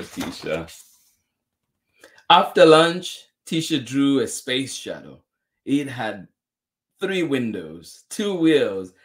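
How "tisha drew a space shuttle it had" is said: ordinary delivery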